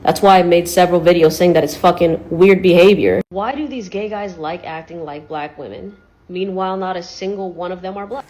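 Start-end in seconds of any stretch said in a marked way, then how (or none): 3.3-8.2 much softer, like a clip playing underneath; quiet background replay. Real, not acted. Clip replayed softly beneath another creator's reply. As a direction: let it sit far under the talk.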